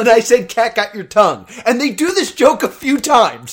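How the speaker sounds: loud, exasperated ranting. Real, not acted. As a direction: rant it out at full volume. Ranting loudly about the joke.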